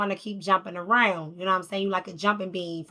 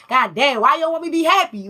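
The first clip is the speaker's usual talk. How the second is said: higher, louder, fast exasperated protest; exasperated outcry; 6 semitones higher, 6 dB louder, faster than usual. Retold or imitated. Tarot reader voicing the guy protesting to his family, exasperated. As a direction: throw up your hands: why won't you let me be happy. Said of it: "now I'm hearing it".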